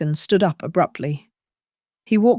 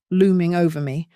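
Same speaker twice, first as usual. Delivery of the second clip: usual speaking voice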